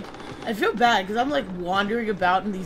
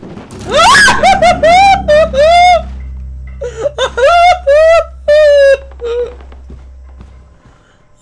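shrieking laugh; 19 dB louder than usual. Real, not acted. Laughing at game.

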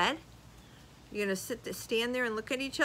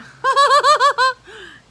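big high burst of laughter; loud high laugh; 17 dB louder than usual. Real, not acted. Vlogger laughing hard at the worst music comment.

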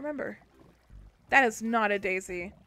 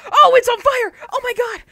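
much higher, much louder cry of alarm; alarmed shout; 11 semitones higher, 13 dB louder than usual. Real, not acted. Streamer shouting in alarm at fire in game. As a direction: yell as the fire flares up.

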